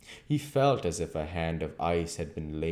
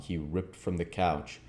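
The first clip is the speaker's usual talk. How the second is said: ordinary delivery, nothing marked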